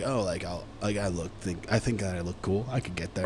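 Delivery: low, hushed musing to oneself; imitated self-talk. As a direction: talk quietly to yourself as someone trying to seem cool. Voicing people acting cool.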